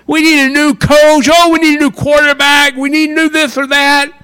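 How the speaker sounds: high, loud griping about the coach; angry fans. Retold or imitated. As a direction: complain loudly like a disgusted fan. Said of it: "your friends go".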